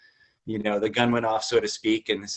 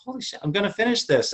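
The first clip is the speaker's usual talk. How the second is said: excited disbelief; 11 semitones higher than usual. Retold or imitated. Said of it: "and, like".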